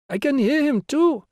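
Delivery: put-on very high, loud child's voice; little girl. A narrator's reading. Little girl volunteering in class, high piping voice. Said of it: "a little girl volunteered".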